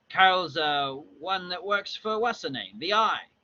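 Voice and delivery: casual put-on Cockney; Cockney accent. Cockney drinker trying to recall a name, casual accent. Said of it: "with the Cockney accent".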